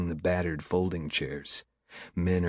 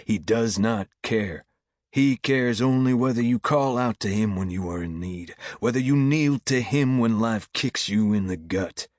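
raised, emphatic preacher's voice; fervent preaching; 3 semitones higher, 4 dB louder than usual. A narrator's reading. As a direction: preach it out over a noisy crowd.

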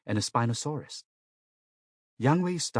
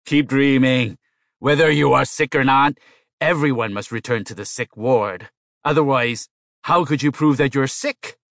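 loud, higher, scoffing retort; proud mocking; 6 semitones higher, 10 dB louder than usual. A narrator's reading. Ward patients laugh at the protester, proud and mocking. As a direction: crow it out proudly at the newcomer. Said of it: "The patients laughed proudly".

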